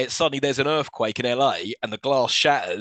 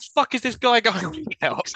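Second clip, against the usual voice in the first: incredulous mock; 10 semitones higher than usual. Imagined realistic crowd reaction.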